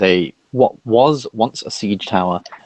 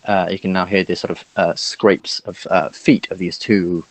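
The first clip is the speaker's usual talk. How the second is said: usual speaking voice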